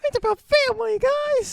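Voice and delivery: put-on very high, loud kid voice; nine-year-old action hero. Co-host imagining the star at nine, squeaky kid voice. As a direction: squeak it like a little tough guy. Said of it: "eleven at the time? ... Can you imagine?".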